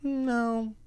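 put-on high, questioning little-girl "No"; imitating the daughter's puzzled no; quizzical toddler no. Dad mimics daughter's quizzical no to him. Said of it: "she'll go".

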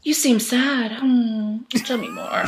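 fish for drama like a TV therapist; mock therapist host. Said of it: "T V brain is like".

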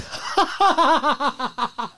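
squealing laugh; high giggle burst; high burst of laughter. Real, not acted. Laugh after line.

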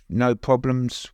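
ordinary talk

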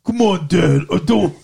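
loud, hammed-up Muppet-like whine; the son's voice. Son pleading with his dad, hammed-up put-on voice. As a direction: plead in a strained cartoon voice. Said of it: "Oh, I forgot Ray's voice".

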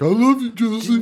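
high-pitched, hammed-up lovey voice; mock declaration of love. Host mocking the film's ending, gushing. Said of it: "when it's just like".

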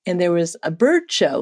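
ordinary talk, nothing marked

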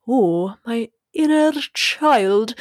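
put-on slow, overly solemn "Ooh, my inner child"; mock serious. Mocking how serious "inner child" sounds. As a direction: parody a heavy therapy tone. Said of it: "instead of saying, inner child sounds so serious".

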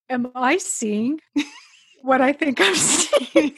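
slow, with a laugh breaking in; laughing amazement at the gold pin; amazed disbelief. Retold or imitated. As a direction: ask it like your eyes are lying to you. Retelling finding gold safety pin.

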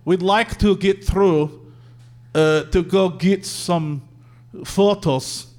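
put-on slow, halting Russian-accented English. Russian couple asking to get through, thick accent. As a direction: imitate a Russian tourist, word by word. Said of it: "like, Russian trophy bride".

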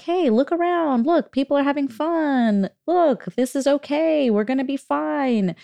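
put-on high, sing-song parent reassuring kids; upbeat reassurance. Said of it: "I was just sort of like".

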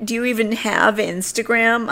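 high-pitched snarky mimicry; mock condescension. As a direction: imitate her talking down, pitch held up high. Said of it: "her calling me grandma and like".

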